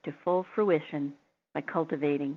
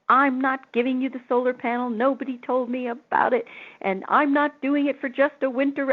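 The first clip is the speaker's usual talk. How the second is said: higher and louder, ranting; mock angry owner; 6 semitones higher, 5 dB louder than usual. Quoting the furious owner refusing the panel, higher and louder. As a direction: play the owner going crazy on the phone. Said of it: "And she went crazy".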